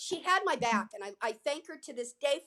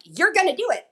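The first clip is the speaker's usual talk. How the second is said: higher, much louder push; insistent urging; 4 semitones higher, 11 dB louder than usual. Retold or imitated. Audience members urging her on, loud and insistent. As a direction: press someone firmly to do it.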